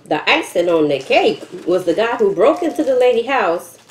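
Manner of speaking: ordinary talk, nothing marked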